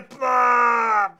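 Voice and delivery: loud mock vomiting noise, hammed-up; mock retching. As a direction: fake a big loud retch for the kids. Vomiting in kids' lesson.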